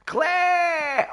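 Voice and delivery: high, loud call of a name. Real, not acted. Greeting a viewer named Claire with a high loud call.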